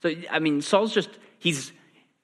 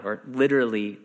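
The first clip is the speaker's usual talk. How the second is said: ordinary talk, nothing marked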